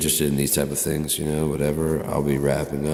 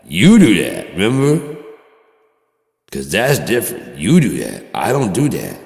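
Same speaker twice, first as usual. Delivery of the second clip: loud, higher back-and-forth accusation; mock shouted quarrel; 3 semitones higher, 8 dB louder than usual. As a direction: throw the blame back, yelling. Mock argument with himself.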